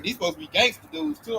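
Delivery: ordinary talk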